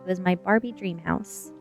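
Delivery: ordinary delivery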